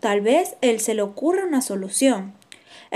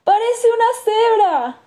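high, louder taunting sneer; mocking tone; 11 semitones higher, 6 dB louder than usual. A narrator's reading. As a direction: jeer it like a bird poking fun. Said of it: "con tono de burla".

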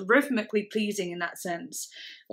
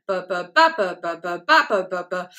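"ba, ba, ba" with a later ba punched louder; shifted accent; 3 semitones lower, 6 dB louder than usual. Shown as a demonstration. Showing the accent can go wherever you want, later "ba" stressed. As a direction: start light, then hit a later "ba" hard. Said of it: "wherever you wanna put it".

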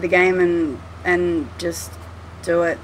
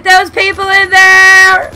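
alarmed yell; 10 semitones higher, 13 dB louder, slower than usual. Real, not acted. Player startled mid-remark in the game, alarmed yell. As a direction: build into a high, loud yell. Sudden fright during game.

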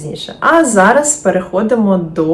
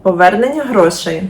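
ordinary talk, nothing marked

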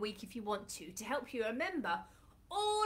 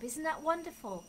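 usual speaking voice

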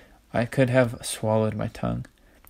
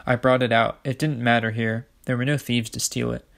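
usual speaking voice

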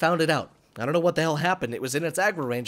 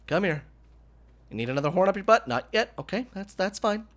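ordinary talk, nothing marked

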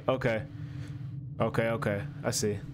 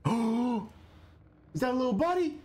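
delighted gasp; 8 semitones higher, much slower than usual. Real, not acted. Spotting a small companion.

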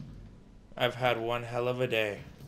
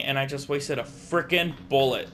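ordinary talk

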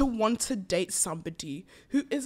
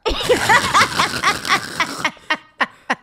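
loud laugh; 7 semitones higher, 9 dB louder than usual. Real, not acted. Laughing at her own story.

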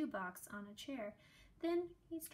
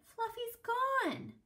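much higher and slow, dismayed; girl finding the empty box, high and dismayed; upset cry; 13 semitones higher, 6 dB louder, slower than usual. A narrator's reading. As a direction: cry it in alarm. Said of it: "she cried".

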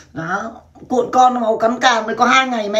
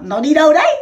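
higher, louder startled ask; surprised question; 6 semitones higher, 5 dB louder than usual. Retold or imitated. Asking the fan where he went, surprised.

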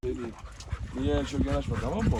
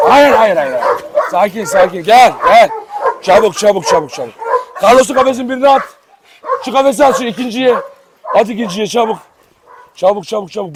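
louder, hurried orders to helpers; urgent commands; 4 semitones higher, 4 dB louder than usual. Real, not acted. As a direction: bark out orders in the chaos. Kavgada yardımcılara komut veriyor. (yardımcılara telaşla yüksek sesle komut veriyor)

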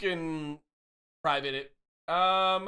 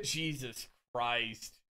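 slow, soft groan of disbelief; exasperated; 6 semitones lower, 9 dB quieter, much slower than usual. Real, not acted. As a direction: drag it out, exasperated. Exasperated reaction.

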